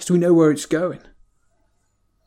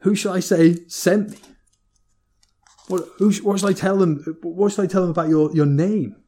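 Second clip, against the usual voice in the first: lightly acted, higher-pitched anxious questioning; a hint of a flustered Moses, stumbling over questions; 4 semitones higher than usual. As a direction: lift the pitch a little as Moses querying God. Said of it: "Moses comes back again".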